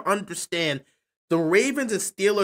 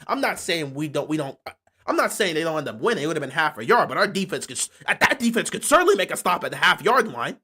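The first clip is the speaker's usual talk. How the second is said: normal talking voice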